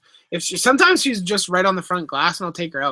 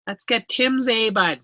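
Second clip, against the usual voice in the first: put-on Canadian accent, higher than usual; 4 semitones higher than usual. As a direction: say it like a hammed-up Canadian. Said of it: "No, I said A, bud".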